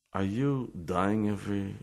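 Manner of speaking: ordinary talk, nothing marked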